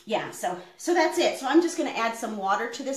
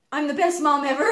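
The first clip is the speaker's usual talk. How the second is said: laughing brag; 5 semitones higher, 6 dB louder, faster than usual. Real, not acted. Self-mocking brag while cooking.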